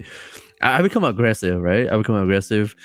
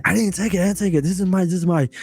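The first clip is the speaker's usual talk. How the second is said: higher, protesting denial; defensive friend; 8 semitones higher, faster than usual. Retold or imitated. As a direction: insist innocently, pitched up. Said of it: "And he was like".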